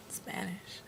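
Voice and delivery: breathy whispered aside; whispering. Pretend. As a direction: whisper it to yourself. Jokey whispered aside to camera.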